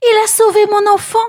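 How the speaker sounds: quick, high, overjoyed; joyful. A narrator's reading. Mother overjoyed that her child is saved, high and quick. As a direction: burst out with relief. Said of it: "se réjouit Jochebed".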